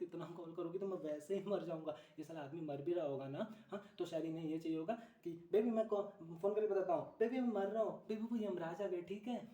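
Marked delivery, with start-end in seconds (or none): 5.5-9.5 loud, high mock phone report; comic last-gasp call to a girlfriend; dying boyfriend. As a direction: cry out to baby in hammed-up distress.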